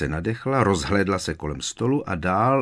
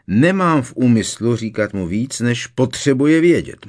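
loud, curt snap at Molly; snapping; 6 semitones higher, 8 dB louder than usual. A narrator's reading. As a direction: bite the words off sharply. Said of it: "ocekl Sirius".

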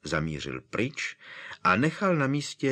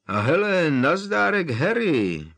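high, louder forced breezy greeting; would-be carefree; 9 semitones higher, 6 dB louder than usual. Acted. Caught Mundungus greets Harry, forced carefree hello. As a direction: fake a carefree hello, not convincing. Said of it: "pozdravil Mundungus Fletcher rádoby bezstarostně".

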